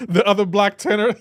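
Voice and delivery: words broken by laughter; laughing through it. Real, not acted. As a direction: giggle the phrase out. Laughing while saying it.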